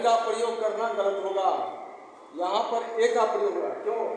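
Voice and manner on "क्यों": ordinary talk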